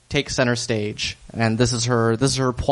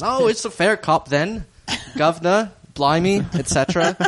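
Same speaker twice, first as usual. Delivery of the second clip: higher-pitched mock Cockney thief; 5 semitones higher than usual. Caught burglar owning up, in a Cockney accent. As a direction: put on a hammy Cockney accent. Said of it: "Cockney thief accent".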